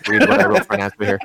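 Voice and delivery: high, quick words through real laughter; choked up, laughing. Real, not acted. Fan reading out his favorite moment, choked up and laughing. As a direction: struggle to read it out, laughing. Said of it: "it's hard for me to even".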